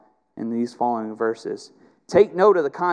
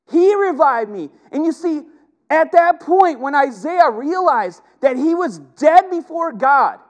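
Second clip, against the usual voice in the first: high, loud preacher's cry; fiery pulpit climax; impassioned preaching; 12 semitones higher, 6 dB louder than usual. Acted. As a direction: preach it at full force, voice up high. Preaching at full force.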